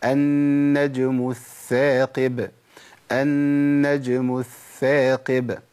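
النجم الثاقب very slow, the ث of الثاقب breathy and soft; ثاء رخوة واضحة; soft tha shown. Shown as a demonstration. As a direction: draw out الثاقب so its th hisses softly. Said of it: "لازم أبين الث- رخاوة في الثاء الأولى".

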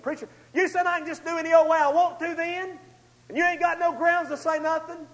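high, pushy voice of a doubting churchgoer; mock objector. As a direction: play a smart-aleck listener challenging the preacher. Voicing an imagined objector in congregation.

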